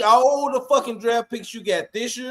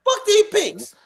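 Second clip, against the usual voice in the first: excited shout; 13 semitones higher, slower than usual. Real, not acted. Fans rallying against draft picks.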